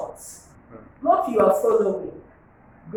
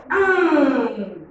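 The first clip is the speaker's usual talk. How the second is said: usual speaking voice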